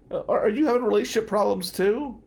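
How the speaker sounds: high, fast, tearful question; teary question. Retold or imitated. Crying lawyer asking the comic about his marriage, high and tearful. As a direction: blurt it out through tears.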